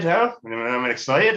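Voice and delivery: usual speaking voice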